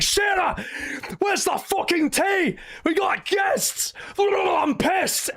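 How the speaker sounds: high mock shouting rage; imitating Sid yelling. Sid yelling at Shearer over the missing tea, hammed-up high furious shouting. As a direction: bellow at the host for tea. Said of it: "It's like".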